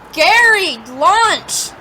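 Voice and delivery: loud call across the house. Acted. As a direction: call your son in for lunch. Mom calls son for lunch.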